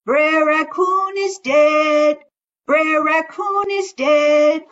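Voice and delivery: loud slow call across the river; loud drawn-out call. Acted. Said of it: "he called out".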